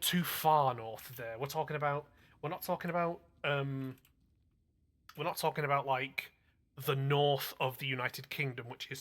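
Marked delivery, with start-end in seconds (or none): none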